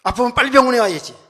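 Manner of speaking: loud, high reproach; mock-angry doctor's telling-off; imitated scolding. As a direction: chew out a patient like a gruff doctor. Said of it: "이렇게 막 책망하는 거예요".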